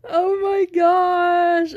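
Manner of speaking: loud, high, drawn-out thrill; awed excitement. Real, not acted. Shoe fan seeing her new pair, awed excitement. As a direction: stretch it out, overcome with delight. First sight of the new shoes.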